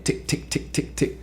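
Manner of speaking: soft whispered tick tick of claws. Retold or imitated. Telling the ghost story, whispering the claw ticks. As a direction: breathe out the ticks very softly.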